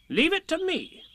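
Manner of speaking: very high, confident Rabbit; Rabbit's character voice. A narrator's reading. Rabbit taking charge of asking Tigger, high and confident. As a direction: take charge in a high-pitched voice.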